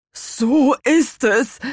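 slow, high, portentous nod in words; grim confirmation. A narrator's reading. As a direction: draw it out gravely. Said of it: "mit düsterem Blick".

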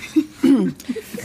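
short throat clear. Real, not acted. Friend clears throat before questioning the woman, throat clearing.

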